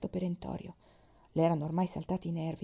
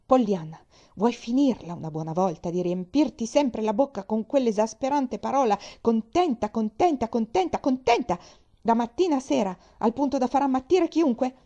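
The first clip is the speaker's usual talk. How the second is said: loud and sharp, repeating contenta with rising exasperation; exasperated aunt lashing out, louder on each contenta; cutting outburst; 4 semitones higher, 8 dB louder than usual. Acted. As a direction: blow up at the girl, biting every word. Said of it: "con voce tagliente sbottò".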